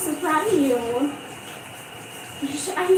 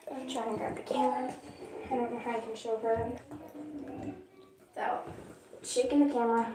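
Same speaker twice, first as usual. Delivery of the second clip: much softer, quick muttered aside; quiet aside; 11 dB quieter, faster than usual. Real, not acted. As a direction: talk under the breath to viewers. Aside while filming.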